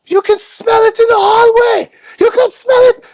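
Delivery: shrieking high and loud, furious; angry woman yelling at the wrestlers over her room; furious German woman. Retold or imitated. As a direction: scream it like a livid hotel guest. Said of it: "she's furious".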